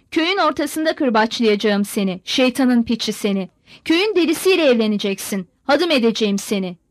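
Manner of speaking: usual speaking voice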